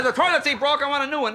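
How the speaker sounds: lightly voiced dad making a claim; mock-serious demand for a replacement; demanding father.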